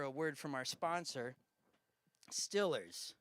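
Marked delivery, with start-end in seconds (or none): none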